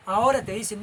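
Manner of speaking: ordinary talk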